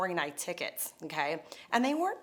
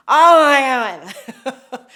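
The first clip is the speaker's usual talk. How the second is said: put-on drunk husband; 4 semitones higher, 15 dB louder, much slower than usual. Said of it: "In his drunkard state".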